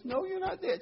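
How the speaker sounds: high-pitched mock protest; lightly voiced fed-up old husband; exasperated husband. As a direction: brush off her silly claim. Joke, husband contradicts wife.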